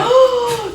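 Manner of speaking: sharp high gasp; shocked gasp. Real, not acted. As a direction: gasp in mock outrage. Shocked at reveal.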